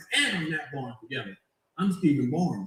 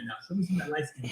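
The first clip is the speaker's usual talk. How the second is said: ordinary delivery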